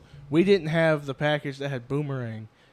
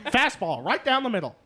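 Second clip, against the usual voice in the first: high, excited play-by-play call; baseball announcer; 10 semitones higher, 3 dB louder than usual. Acted. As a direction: call the pitch like an old radio announcer. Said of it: "Baseball announcer".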